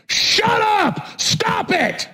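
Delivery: high-pitched angry cry; exasperated outburst. Real, not acted. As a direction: yell it in sheer exasperation.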